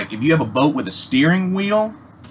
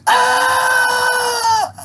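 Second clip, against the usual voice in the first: movie scream; 4 dB louder than usual. Retold or imitated. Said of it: "you're like".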